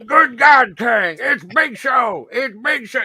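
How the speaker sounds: high, loud, slow shouted commentary; J R impression. Doing J R screaming to King about Big Show, high shouting. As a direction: holler it like a frantic ringside announcer. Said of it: "this impression right here".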